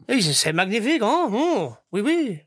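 hammed-up French chef, high; over the top oui oui, chef kissing fingers. Mock French banter.

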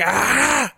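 high startled mock cry; imitated yell. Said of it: "He's like".

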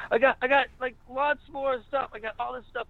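high-pitched mock-desperate protest; retold pleading. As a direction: plead your case high, acting it out. Pleads with bandmates in retold scene.

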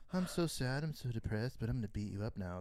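mopey Batman mock. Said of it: "I hated that Batman".

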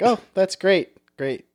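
excited start, flat dead finish; gush, then drop to flat; deadpan. Pretend. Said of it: "played like deadpan".

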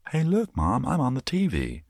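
put-on high-pitched excited kid calling to mom. Pretending to be a child calling his mom, high and excited. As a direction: squeal it like a kid spotting himself on TV. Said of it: "I've never said".

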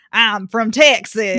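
slow, drawn-out Texas twang; strong Texas accent. Shown as a demonstration. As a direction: lay on a big Texan accent, stretching the words. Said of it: "Jasper should have a strong".

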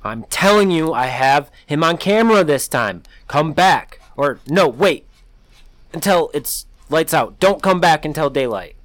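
wife begging her husband to stay away until daylight; frightened urgency. A narrator's reading. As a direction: insist in a rush of fear.

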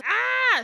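short high-pitched acted scream; hammed-up little shriek of fright; mock scream. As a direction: yelp in comic terror. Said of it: "So she starts screaming".

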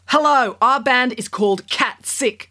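Kat voice. A narrator's reading. Kat announcing band on stage.

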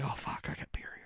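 hushed, nervous whisper; whispered panic. Retold or imitated. Panicked aside about beer.

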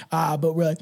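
normal talking voice

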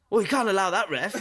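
high, loud complaint to the ref; retold player protest. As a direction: imitate an outraged goalkeeper confronting the referee. Retold goalkeeper confronts the referee.

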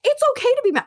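high-pitched protest back at godmother; retold retort. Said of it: "I'm like".

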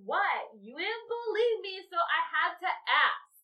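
put-on high, loud drunken indignation; hammed-up tipsy outrage; indignant protest. Drunk woman argues with her friend.

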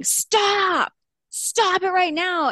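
retold scream. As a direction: imitate his shrill yelled order. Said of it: "he starts screaming".

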